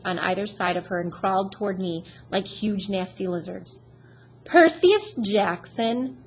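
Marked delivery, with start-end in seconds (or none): 4.4-6.2 slow, louder Southern drawl; a hint of a menacing Southern teacher; southern accent. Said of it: "in an accent that was definitely from somewhere farther south than Georgia".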